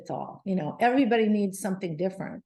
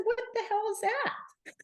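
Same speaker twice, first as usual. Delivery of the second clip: soft but far higher and strained with disbelief; incredulous outburst; 13 semitones higher, 4 dB quieter than usual. Retold or imitated. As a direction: squeeze the question out in outraged disbelief. Indignant disbelief at the diagnosis.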